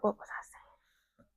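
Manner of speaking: whispered aside after losing the thread; barely audible self-question; muttered to self. Real, not acted. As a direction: whisper it to yourself, searching for your place. Lost her thread, asks herself.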